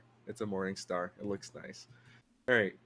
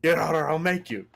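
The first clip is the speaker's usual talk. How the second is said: threatening; 5 semitones higher, 6 dB louder than usual. Acted. NPC threatening intruder.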